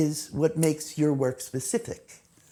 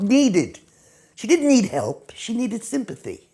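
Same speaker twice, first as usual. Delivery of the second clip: normal talking voice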